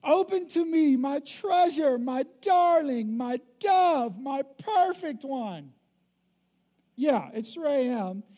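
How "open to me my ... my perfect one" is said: higher, louder, slow crooning plea; hammed-up pleading lover. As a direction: lay it on thick like a smitten husband. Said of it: "he's laying it on".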